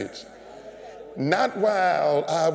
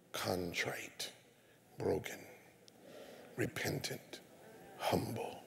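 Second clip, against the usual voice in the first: contrite whisper; 6 semitones lower, 16 dB quieter than usual. Retold or imitated. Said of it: "was this person".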